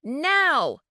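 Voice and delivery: barked command. A narrator's reading. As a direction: snap the order at him. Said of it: "she barked at him".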